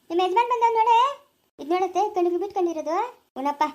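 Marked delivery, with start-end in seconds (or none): 0.1-1.0 higher, louder call across the house; announcing her husband's arrival to the new mother, loud call. Acted. Calling out that husband has come.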